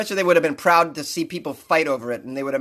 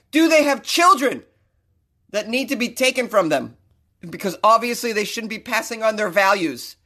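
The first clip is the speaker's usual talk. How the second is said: exasperated shout; 5 semitones higher than usual. Real, not acted. Comedian repeating the question, now yelling in outrage. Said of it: "I'm getting a little excited".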